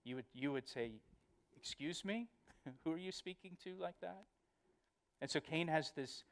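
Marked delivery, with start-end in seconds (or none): 1.5-4.2 higher, softer, incredulous question; quiet mock indignation, rising in challenge; affronted parent. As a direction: play a parent pulling up a cheeky child. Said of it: "those of you that are parents".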